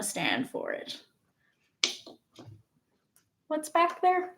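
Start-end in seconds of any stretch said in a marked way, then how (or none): 3.5-4.3 high cutesy voice for the cat. Pretend. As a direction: coo at the pet playfully. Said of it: "annoying cat voice".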